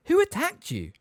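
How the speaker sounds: high alarmed question; shocked and demanding. Acted.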